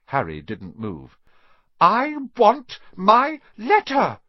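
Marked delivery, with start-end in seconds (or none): 1.8-4.2 high, slow, loud shout. A narrator's reading. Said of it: "he shouted".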